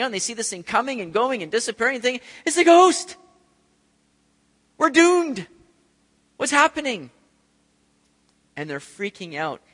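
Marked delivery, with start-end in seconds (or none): 2.4-7.1 high, loud frightened "it's a ghost"; panicking disciples crying out; mock terror. Disciples panicking at a ghost on the water, high and loud mock fear.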